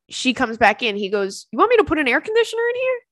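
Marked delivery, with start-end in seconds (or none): 1.5-3.0 high, fast mock offer; lightly voiced guy. Retelling the guy's offer to her friend, high and fast. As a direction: toss off the helpful offer.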